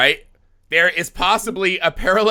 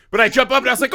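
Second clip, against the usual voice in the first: ordinary talk, nothing marked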